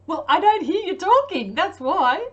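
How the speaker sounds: loud, high, hammed-up comeback; mock yelling. Vlogger joking back at a viewer about accents, mock yell.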